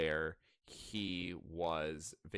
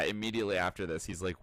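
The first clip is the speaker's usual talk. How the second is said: ordinary talk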